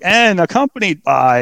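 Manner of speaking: a hint of an arena introduction; ring announcer.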